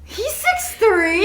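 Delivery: stunned, drawn-out rising question; surprised question. Real, not acted. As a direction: react in shock to the news. Shocked that the ex is tall.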